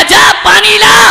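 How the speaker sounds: loud, slow barked command; father ordering his son, slow; gruff father order. Acted. Angry father sending son for water, shouted. उधर से बाप कहेगा.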